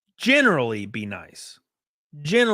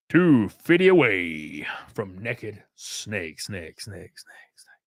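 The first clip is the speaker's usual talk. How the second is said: slow, low, fading away to a whisper; mock announcer echo trailing off; drawn-out hype line echoing into nothing; 5 semitones lower, much slower than usual.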